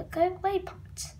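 ordinary talk

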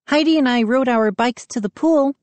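high, chirpy reply; little sister chiming in at dinner; piping up. A narrator's reading. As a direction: pipe up brightly. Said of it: "Mindy piped up".

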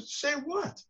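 high, startled Say what; stunned disbelief. Retold or imitated. Dave stunned when told to accept the award, in high startled disbelief. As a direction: squeak it out in shock.

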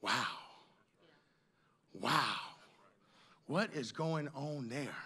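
slow, low-pitched wonder with long pauses; acted amazement. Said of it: "they'll see you and they'll say".

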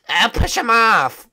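put-on high, slow old-librarian voice; Bushy impression. Mocking a player's voice for the lobby. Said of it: "Bushy was just like".

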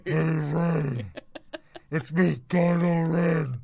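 lightly ghostly voice, slowing on the name; a hint of a drawn-out spooky villain; Kylo Ren. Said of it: "your Kylo voice is very similar to your ghost voice".

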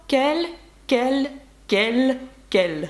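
«кель» repeated, sounding the same each time; «кель» said identically, quel quelle quels quelles; same sound four times. Shown as a demonstration. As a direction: say «кель» alike for all four spellings. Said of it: "на слух це звучить однаково".

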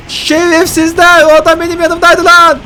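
high, loud, slow hammed-up anguish; overdone fake mourning for a teammate; mock war-movie grief. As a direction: wail like a movie soldier losing his buddy.

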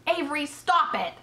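high, sharp reprimand; mother scolding. A narrator's reading. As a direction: call the child to order. Mother scolds show-off son.

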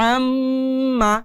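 আম্মা with a long nasal hum on মম; slow Quranic recitation of আম্মা; held nasal ghunnah. Shown as a demonstration. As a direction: hold the মম in আম্মা through the nose. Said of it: "তাহলে উচ্চারণটা কিভাবে হবে".

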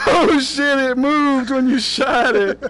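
loud, slow, laughing shriek; startled laugh through the words; laughing shout. Real, not acted. Startled while gaming, laughing.

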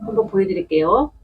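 ordinary talk

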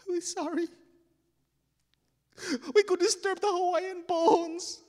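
high, loud sobbing wail; blubbering frightened kid; crying confession. Acted. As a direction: confess through acted sobs. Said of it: "crying in this pool of light".

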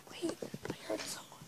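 alarmed hushed whisper. Real, not acted. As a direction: whisper as if someone's near. Hears someone, hushed alarm.

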